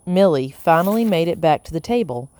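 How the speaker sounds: ordinary delivery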